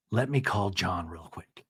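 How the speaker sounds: low whispered quote; ominous hush. Retold or imitated. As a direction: drop to a hushed, slow whisper. Ominous retold line, hushed.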